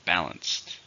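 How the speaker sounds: BAL-anced, first syllable strong; "Balanced" stressed at the start. Shown as a demonstration. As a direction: model "Balanced" with weight on BAL. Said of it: "stress on the first syllable".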